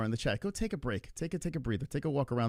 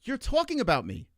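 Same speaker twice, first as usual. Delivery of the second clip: indignant; 9 semitones higher than usual. Real, not acted. To the angry viewer, high indignant retort. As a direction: throw the accusation right back. Heated argument on stream.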